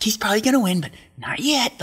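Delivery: high, loud mimicked protest; heated mimicry. Andy refusing to concede to his rival yet, heated high mimicry.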